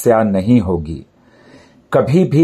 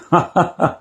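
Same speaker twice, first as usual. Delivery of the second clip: light, higher-pitched little laugh; short chuckle; 7 semitones higher than usual. Real, not acted. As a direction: chuckle briefly after a tricky recital. Chuckles after reciting difficult letters.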